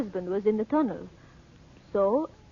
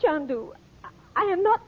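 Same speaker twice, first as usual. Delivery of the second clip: tearful protest; 6 semitones higher than usual. Acted.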